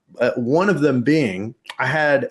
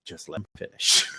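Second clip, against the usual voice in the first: deadpan sister; 7 semitones lower, 7 dB quieter than usual. Retold or imitated. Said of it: "my sister was like".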